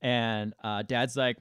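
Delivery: ordinary delivery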